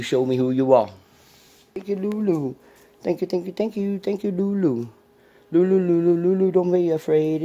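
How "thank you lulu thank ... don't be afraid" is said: high sing-song pet voice. Real, not acted. Thanking the dog Lulu in high baby talk. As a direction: coo at the dog, much higher.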